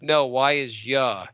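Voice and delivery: "ya" drawn out very slowly as the right sound; correcting Y slowly. Retold or imitated. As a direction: say "Y is ya" slow and patient, stretching "ya". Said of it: "I'm like".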